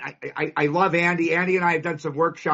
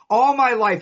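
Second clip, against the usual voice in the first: emphatic call; 5 semitones higher, 5 dB louder than usual. Real, not acted. Speaker punches opening phrase.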